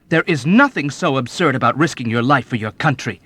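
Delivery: loud earnest declaration; young officer defending patriotism; firm declaration. A narrator's reading. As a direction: declare it with conviction. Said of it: "he declared".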